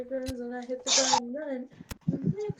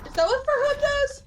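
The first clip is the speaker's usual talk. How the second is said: surprised; 13 semitones higher, 6 dB louder than usual. Real, not acted. Surprised by the furry map.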